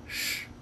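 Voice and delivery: ch as a soft, bare consonant; isolated ch sound. Shown as a demonstration. Teacher showing what the symbol stands for, bare ch. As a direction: let ch sound alone, softly. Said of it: "qui fait office de".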